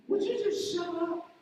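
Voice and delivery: high, loud snap of shut up; fed-up shout. Retold or imitated. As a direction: yell at the nagging voice to stop. Telling off her inner bully.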